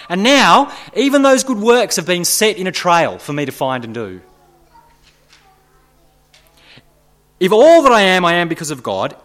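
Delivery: loud, high, urgent preaching; impassioned preaching. Acted. Preacher urging the congregation, loud and high-pitched. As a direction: drive it home at full force to the church.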